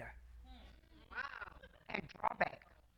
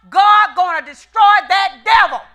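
shouted; 4 semitones higher, 13 dB louder than usual. Acted. Preaching climax.

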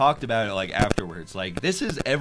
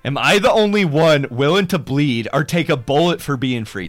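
loud, hammed-up recital of the lyrics; mocking lyric reading; 2 semitones higher, 10 dB louder than usual. Shown as a demonstration. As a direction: read it out like a bad patriotic anthem. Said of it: "I'll read them really fast".

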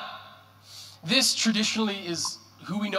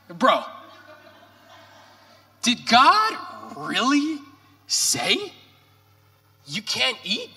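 slow, higher, louder taunting tempter, drawn out; sly mocking devil; 4 semitones higher, 4 dB louder, slower than usual. Devil taunting the woman about God's command, slow sneering tempter. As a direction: lean in and plant doubt like the serpent, slowly. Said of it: "The devil comes in and says".

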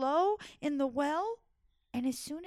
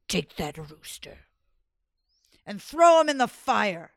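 angry sultan command; 3 semitones lower, 10 dB louder than usual. Acted. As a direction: lower then loud order. Sultan orders.

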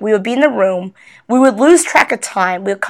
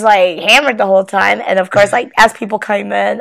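normal talking voice

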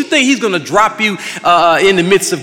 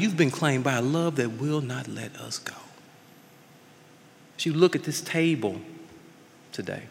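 much softer, lower, intimate pastoral voice; quiet communion invitation; 9 semitones lower, 10 dB quieter than usual. Real, not acted. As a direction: draw them close to the table, gently.

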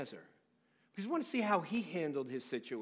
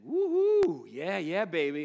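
playful whoop; 7 dB louder, slower than usual. Retold or imitated. Husband teasing his wife, a loud flirty whoop. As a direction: holler it at her like a smitten husband. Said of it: "I'm still like".

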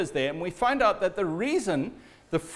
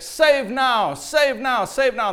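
higher, louder chant that fades a little on each call; crowd shouting back; 5 semitones higher, 4 dB louder, slower than usual. Retold or imitated. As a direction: answer the priests as a crowd, chant by chant. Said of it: "the people are shouting back".

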